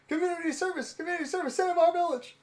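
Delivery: very high, squeaky villager voice; villager impression. Villagers crying out in a favourite scene, high put-on voice.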